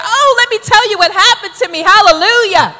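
high, loud, exuberant testimony; fervent, full-voiced witnessing; uncontainable zeal. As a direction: burst out as a new believer who must tell everyone. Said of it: "you just couldn't contain it. The zeal was there".

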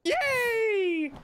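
very high, loud triumphant squeal; squealing yay. Real, not acted. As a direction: shriek with sudden delight.

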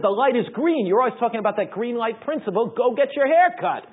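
a wife who won't take no; insistent wife. Retold or imitated. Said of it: "She said".

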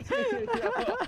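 high-pitched, laughing plea; helpless laughter. Real, not acted. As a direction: beg through your giggles. Laughing, begs to hear it again.

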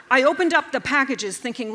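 ordinary delivery

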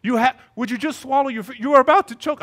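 high, loud mock scolding; exasperated dad. Dad warning toddler about choking, high and exasperated. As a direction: imitate an alarmed father losing patience.

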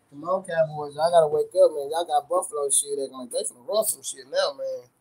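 low, soft, resigned fan talk; deflated muttering. Real, not acted. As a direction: grumble it quietly, losing hope. Deflated by the other team's play.

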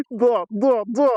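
high, jubilant repeated yeses after a laugh; real elated cries. Real, not acted. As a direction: exult with each yes. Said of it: "Покричав тільки що".

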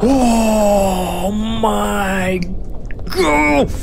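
startled yelp of real fright; real scream at a jump scare; frightened cry. Real, not acted.

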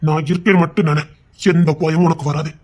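calm her gently, she's safe with you; father softly comforting his scared daughter; tender reassurance. Acted. Father soothing frightened daughter.

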